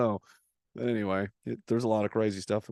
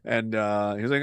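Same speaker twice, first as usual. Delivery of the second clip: ordinary talk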